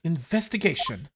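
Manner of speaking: ordinary delivery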